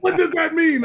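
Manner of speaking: high, loud mock-outraged shout; incredulous yell. [name] imitating Mike's outburst at five a.m., high disbelieving shout. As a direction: hurl the question back in disbelief. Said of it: "Mike was like".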